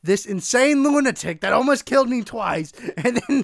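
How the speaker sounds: loud, indignant protest; heated, exasperated tirade; worked-up rant. Real, not acted. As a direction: cut in loudly, refusing to drop it. Refuses to drop the rant.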